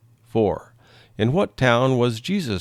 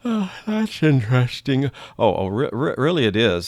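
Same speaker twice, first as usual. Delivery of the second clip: yawning; 2 semitones higher than usual. Acted. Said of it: "Sorry I yawned".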